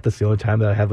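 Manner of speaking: ordinary delivery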